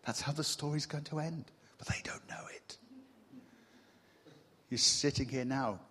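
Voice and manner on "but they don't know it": whisper. Real, not acted. Continues whispering.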